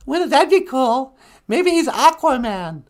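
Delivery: loud, very high character voice; excited high-voiced sidekick. Sidekick sharing a theory with host, excited high voice. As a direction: squeal it with giddy excitement.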